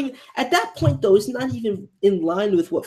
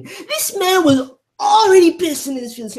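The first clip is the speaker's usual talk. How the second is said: hyped outburst; 14 semitones higher, 9 dB louder than usual. Real, not acted. Reactor hyped describing the character.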